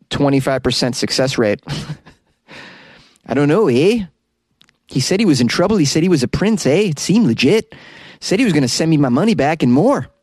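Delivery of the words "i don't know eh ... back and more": lilting mock Canadian accent, pitched up; hammed-up Canadian accent, rising at phrase ends. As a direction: play a gullible Canadian with a singsong accent. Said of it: "this Canadian accent".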